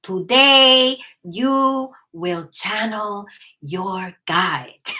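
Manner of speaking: put-on slow, loud, measured accented proclamation; drawn-out accented command, dropping at the end; Metatron's accent. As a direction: imitate a stately teacher's accent, word by word. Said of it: "he talks like that".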